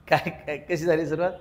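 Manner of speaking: ordinary delivery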